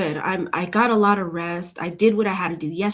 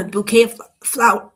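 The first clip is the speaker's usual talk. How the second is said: choking while talking; 4 semitones higher, 8 dB louder than usual. Real, not acted. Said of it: "Went down the wrong pipe".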